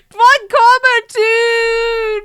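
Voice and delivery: loud, high, slow Von Karma two; anguished wail. Real, not acted. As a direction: wail it like a doomed sequel. Despair at successor news.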